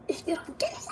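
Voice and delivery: mom's retold yell, pitched high. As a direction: mimic mom yelling at you, up high. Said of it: "All right, you done yelling".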